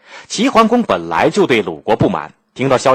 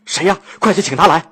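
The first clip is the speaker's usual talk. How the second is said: high, eager question and order; urgent ruler demanding help; anxious urgency; 6 semitones higher than usual. A narrator's reading. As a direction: jump in impatiently, voice pitched up. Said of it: "鲁庄公着急地问道".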